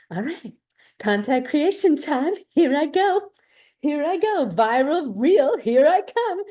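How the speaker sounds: high, loud pumped-up voice; psyched-up creator. Retold or imitated. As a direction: hype yourself up to create. Eager creator psyching herself up.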